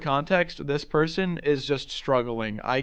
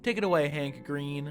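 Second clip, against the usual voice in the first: normal talking voice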